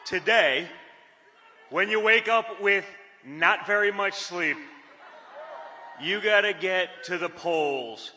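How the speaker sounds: rousing get-out-the-vote rally call; rally speech. Acted. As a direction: urge the crowd to the polls. Urges the crowd to vote.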